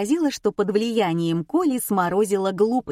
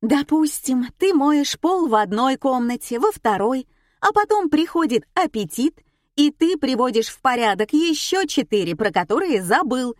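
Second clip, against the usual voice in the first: higher, lively explaining woman; lightly voiced eager hostess; 6 semitones higher, 3 dB louder than usual. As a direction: explain it brightly like a radio host. (бойкая ведущая, чуть выше)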